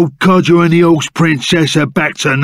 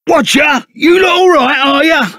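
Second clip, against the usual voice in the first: cheery greeting; 8 semitones higher than usual. Acted.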